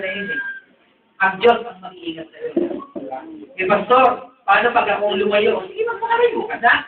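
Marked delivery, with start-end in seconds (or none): 5.7-6.7 mataas na boses. Acted. Pastor voicing permissive reply.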